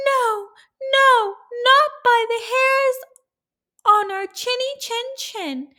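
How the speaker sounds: high squeaky little pig. A narrator's reading. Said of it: "so they answered back".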